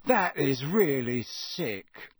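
slow, appalled repeat; slow disgusted. Acted. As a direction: drag it out slowly in disgust. Ron disgusted at the cruelty.

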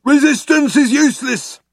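high, loud brutish guard's slogan; shouted. A narrator's reading. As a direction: bellow it like a thick-headed guard. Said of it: "shouted the Vogon guard".

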